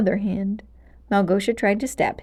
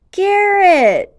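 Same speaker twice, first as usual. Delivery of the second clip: high, loud cry of his name; overjoyed shout of a friend's name; joyful exclaim, much higher and louder; 11 semitones higher, 9 dB louder than usual. Acted. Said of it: "Henry exclaimed".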